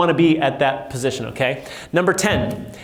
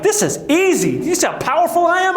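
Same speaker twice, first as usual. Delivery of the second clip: high, smug boasting, pitched up; mock cocky; 12 semitones higher than usual. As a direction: play the overconfident rookie. Cocky young self boasting.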